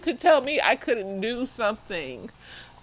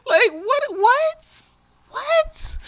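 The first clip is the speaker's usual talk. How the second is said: incredulous what; 11 semitones higher, much slower than usual. Acted. Outraged disbelief.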